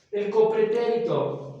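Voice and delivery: normal talking voice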